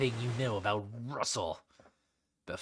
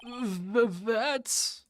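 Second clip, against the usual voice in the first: shocked stammer; 9 semitones higher than usual. Acted. Shocked at evidence.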